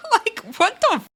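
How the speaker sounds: high burst of real laughter; laughing. Real, not acted. Laughing at the funniest fight she has seen, real high laugh. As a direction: crack up mid-question.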